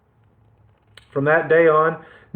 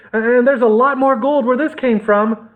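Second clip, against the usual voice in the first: put-on higher, eager boy's voice; boy tempting robbers; 9 semitones higher than usual. A narrator's reading. Captive boy lures greedy robbers, in an eager higher voice. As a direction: dangle the gold before them.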